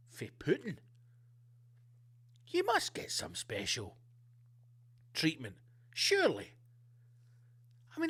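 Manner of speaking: usual speaking voice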